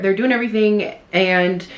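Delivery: usual speaking voice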